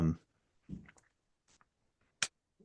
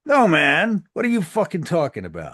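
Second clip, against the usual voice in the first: angry; 10 semitones higher, 11 dB louder than usual. Acted. GM as Darren, angry denial.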